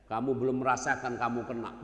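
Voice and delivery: ordinary talk, nothing marked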